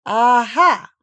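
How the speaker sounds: loud, drawn-out trial aha; practising an exclamation. A narrator's reading.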